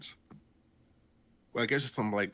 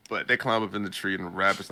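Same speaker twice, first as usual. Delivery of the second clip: usual speaking voice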